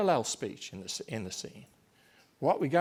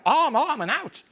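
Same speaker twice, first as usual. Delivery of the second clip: very high, loud shouted rally; angry call to arms; 12 semitones higher, 9 dB louder than usual. Shown as a demonstration. Lecturer re-performing Macbeth's battle cry, angry shout. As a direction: relive the rage as you shout. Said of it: "back into the anger".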